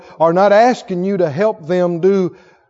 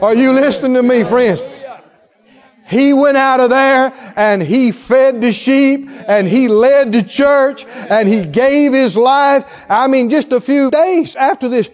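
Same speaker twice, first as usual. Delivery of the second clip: higher and louder, driving each phrase home; preaching at full force; 8 semitones higher, 6 dB louder than usual. Acted. Preacher stirring his congregation, full force. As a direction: preach it to the back of the church.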